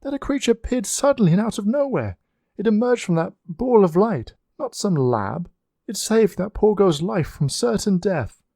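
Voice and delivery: put-on a young woman calling out, demanding to know; young lady crying out. A narrator's reading. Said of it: "a young lady cried from behind".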